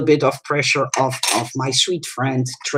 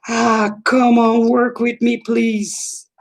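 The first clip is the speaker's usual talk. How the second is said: high pleading coax; begging the can to cooperate; pleading with a machine; 8 semitones higher, slower than usual. Real, not acted. Struggling with the can.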